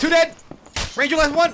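high loud kill callout; excited callout. Real, not acted. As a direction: shout the kill news.

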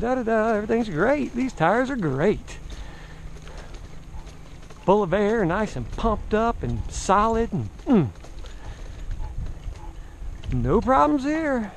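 singsong everything-is-fine act; mock cheer. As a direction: brush off the worry with overdone cheer. Jinx-avoiding pretend cheer about tires.

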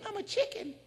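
high, small voice insisting; a meek bird protesting; timid bird voice. Retold or imitated. The bird insisting to the man it is a chicken.

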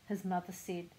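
plain reading voice

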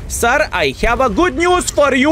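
高声兴奋地喊; 激动地大声宣布; excited shout. Real, not acted. Excited call to enemy.

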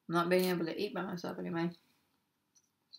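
muffled eating speech; talking while chewing. Real, not acted. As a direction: mouth partly full of food. Seen 4: Bites taco salad at 6.4, then visibly chews while speaking.

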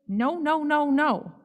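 put-on high insistent child yell. A narrator's reading. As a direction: yell it like a stubborn kid. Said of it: "Jackie yells".

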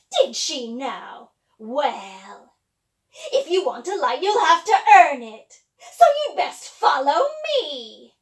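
loud, slow sly witch. Acted. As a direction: taunt her like a witch.

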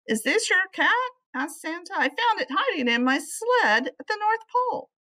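bright holiday Santa presenting the cat; jolly Santa. Acted.

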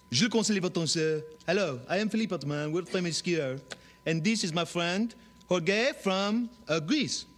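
lightly put-on French accent; hint of a French skier.

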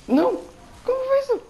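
high startled shout; shocked outcry. Real, not acted. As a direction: cry out in disbelief. Sudden loss in fight.

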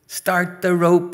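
loud, high skipping-game command; calling out. Acted. Girl starting the rope for the game, calling out. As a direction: call it out slowly over the street.

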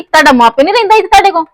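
indignant. Acted. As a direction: hurl the comparison at him.

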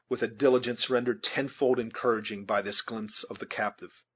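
usual speaking voice

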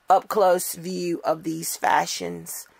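ordinary talk, nothing marked

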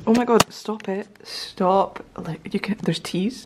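normal talking voice

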